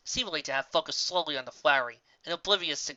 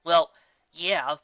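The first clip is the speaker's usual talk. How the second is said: ordinary delivery, nothing marked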